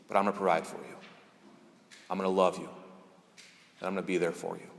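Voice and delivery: low, soft voice with long pauses; tender reassurance. Retold or imitated. God speaking to a scared seminarian, tenderly reassuring. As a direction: reassure gently, letting each promise settle.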